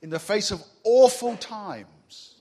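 "awful" punched loud and slow, falling; stress on awful. Shown as a demonstration. Preacher to congregation, "awful" stressed loud and slow. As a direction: hit "awful" hard, slowly. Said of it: "We can use harsher words".

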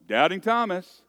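loud, high Doubting Thomas; mock announcing nickname. Pastor showing how everyone says his name, loud. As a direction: say the name like everyone reflexively does. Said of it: "without going".

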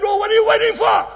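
loud, high rallying shout; preacher urging him on, shouting; excited challenge. Retold or imitated. Excited shouted dialogue retold.

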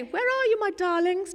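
put-on sing-song call to the kids upstairs; imitating a doting parent calling the children; sweet parent call. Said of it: "And you don't say".

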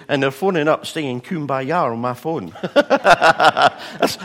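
laughing loudly through the punchline. Real, not acted. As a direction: break up with laughter while telling it.

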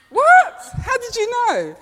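high, loud burst of retold disbelief; mock shock. Preacher retelling her shocked reply to God, loud and high. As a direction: yelp it like you've been caught out.

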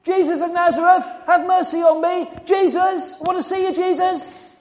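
loud, high desperate call for attention; urgent high-pitched yelling; shouting out. Acted. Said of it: "he began to shout".

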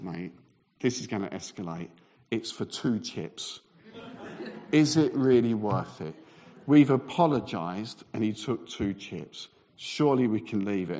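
low, soft reasoning; calming plea. Retold or imitated. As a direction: talk him down quietly. Other bloke reasoning to prevent escalation.

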